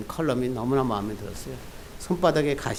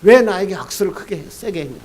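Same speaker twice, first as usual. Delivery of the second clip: a half-acted angry snap, louder at the start; retold angry complaint, louder, then fading; angry retort; 5 semitones higher, 5 dB louder than usual. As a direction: play the hurt man snapping back in mild mock anger. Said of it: "상대방이 막 화를 냅니다".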